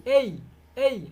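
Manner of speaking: এই, এই drawn out slowly, এ gliding to ই; slow diphthong demo. Shown as a demonstration. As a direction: stretch each এই, sliding from এ to ই. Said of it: "please repeat". (ধীরে ধীরে এই, এই, এ থেকে ই-তে)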